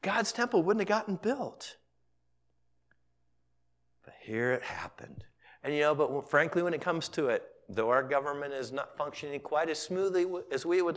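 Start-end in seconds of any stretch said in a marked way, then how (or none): 4.0-5.2 soft unvoiced whisper. Real, not acted. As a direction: confide it in a whisper. Quiet aside in sermon.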